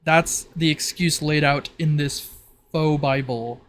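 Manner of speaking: normal talking voice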